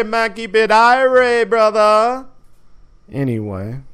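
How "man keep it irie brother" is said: hammed-up Jamaican voice, higher and louder; playful Jamaican patois, stretched out slowly; mock Jamaican accent. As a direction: toss it off in a jokey Jamaican accent. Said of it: "we can do the Jamaican voice".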